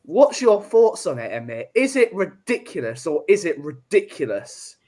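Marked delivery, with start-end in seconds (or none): none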